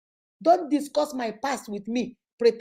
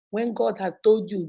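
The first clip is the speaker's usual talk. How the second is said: ordinary talk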